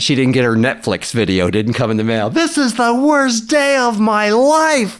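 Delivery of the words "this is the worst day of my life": high, loud, drawn-out mock wail; hammed-up tantrum, slow and high; upset daughter's wail. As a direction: wail it like a melodramatic kid. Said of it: "she was really upset like that".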